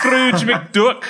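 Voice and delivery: a hint of a Scottish Scrooge McDuck; lightly voiced Scottish duck; Scottish accent try. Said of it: "He sounds like Scrooge McDuck".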